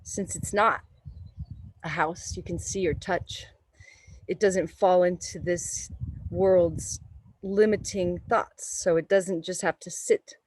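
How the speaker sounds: calm, unhurried meditation voice; guided meditation. Acted. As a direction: guide the breath gently. Leads a yoga meditation.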